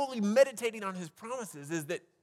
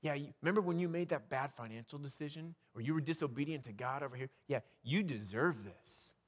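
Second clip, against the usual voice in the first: accusing worry voice; 6 semitones lower, 6 dB quieter than usual. Retold or imitated. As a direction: let worry hiss blame in the ear. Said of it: "that little voice starts to say".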